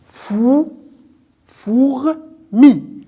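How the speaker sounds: "Fou" alone, then "fourmi" broken into "four" and "mi"; teacher slowly separating "four" ... "mi"; sounding out syllables. Shown as a demonstration. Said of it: "Qu'est-ce qu'on entend?".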